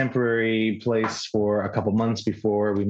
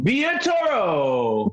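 drawn-out, high announcer name call; hype man shout-out; 8 semitones higher, slower than usual. Pretend. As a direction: stretch the name like a ring announcer. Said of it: "we would need a hype man".

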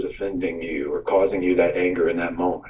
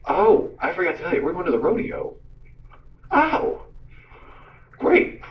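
lightly acted excitement, pitched higher; mock-bright announcement, voice lifting high; upbeat dad; 8 semitones higher than usual. Dad tells daughter about the rodeo, lightly acted high excitement.

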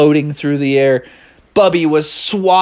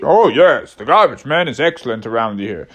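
lower, swooping character voice; low-voiced bear; 4 semitones lower than usual. Another bear praises the garbage man, lower swooping voice. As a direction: agree in a lower, wavering bear voice. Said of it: "they're all like".

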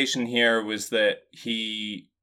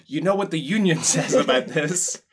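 mock union firefighter; 7 semitones higher, 5 dB louder than usual. Mock firefighter demanding clock-in.

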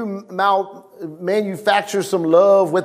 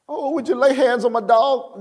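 mock pleading congregant; 5 semitones higher than usual. Mocking a congregant's request.

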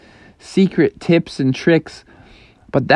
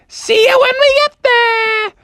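redone mom voice; 3 dB louder than usual. Acted. Mom teasing Froggy about his boarding zone, very high and smug. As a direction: squeak it out like a smug cartoon mom.

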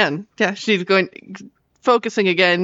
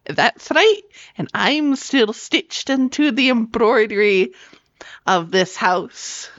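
gloating in a higher, half-whispered voice; smirking delight; 5 semitones higher than usual. Acted. Witch to Gordon as he shirks back, smirking. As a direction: relish it with a smirk, slowing at the end. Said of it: "cannot help but smirk in delight".

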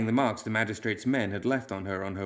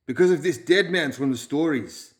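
higher, louder, edged Balkan speech; hard Balkan accent; 5 semitones higher, 6 dB louder than usual. A narrator's reading. Gang leader scoffs at the clerk, hard Balkan accent. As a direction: give the words a hard Balkan edge. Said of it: "his hard Balkan accent giving".

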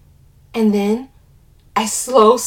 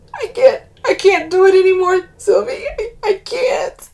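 sobbing; 11 semitones higher, 7 dB louder than usual. A narrator's reading. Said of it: "she sobs".

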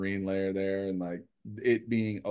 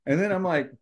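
ordinary talk, nothing marked

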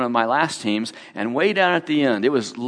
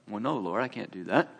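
meek reply; 4 semitones lower, 9 dB quieter than usual. Retold or imitated.

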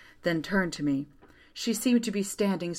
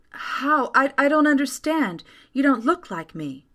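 higher, stammering disbelief; bewildered; 7 semitones higher, 4 dB louder than usual. A narrator's reading. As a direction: stumble over it, raised in confusion. June bewildered meeting other self.

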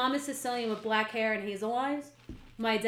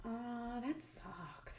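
much softer, flat deadpan; deadpan friend; 17 dB quieter, much slower than usual. Retold or imitated. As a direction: shrug it off, unbothered. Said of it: "my other guy friend goes".

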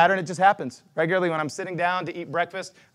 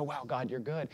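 quiet awe; 4 semitones lower, 11 dB quieter than usual. Retold or imitated. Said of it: "I go".

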